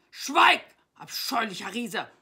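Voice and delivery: very loud, high-pitched command; full-force acted roar; roaring knight. A narrator's reading. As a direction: bellow at a hated foe. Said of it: "brüllte der Ritter".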